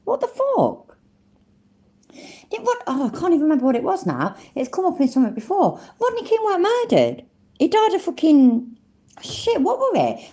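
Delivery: high-pitched, baffled disbelief; genuinely flabbergasted, voice shooting up high; incredulous. Real, not acted. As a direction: squeak in protest at a ridiculous claim. Disbelief at the clip's murder claim.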